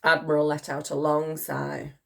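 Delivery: ordinary delivery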